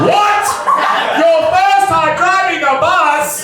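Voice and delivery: high, loud disbelieving yell; incredulous shout. Real, not acted. Comedian to audience, outraged shout of disbelief. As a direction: shout it like you can't believe your ears. Disbelief at driver's first day.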